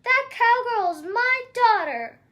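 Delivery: loud, protective shout. Acted. Ma defending her daughter from the giant, loud shout. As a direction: stand up for your girl. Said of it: "she shouted".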